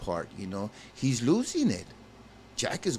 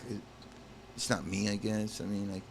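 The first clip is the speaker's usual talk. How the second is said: ordinary talk, nothing marked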